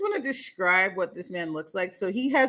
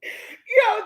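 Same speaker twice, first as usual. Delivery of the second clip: ordinary talk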